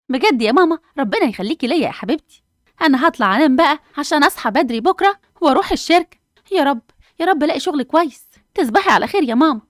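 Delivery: bursting with relief and hope; overjoyed daughter. Acted. Hanaa thrilled about job lead. (فرحة بنت بصوت عالي ومرتفع)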